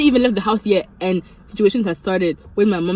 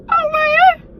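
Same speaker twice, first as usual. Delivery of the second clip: very high, loud outcry; piercingly high dramatic exclamation; exasperated exclamation; 6 dB louder than usual. Acted. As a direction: cry out in disbelief, voice shooting way up. Exclaims over the mother's hunch.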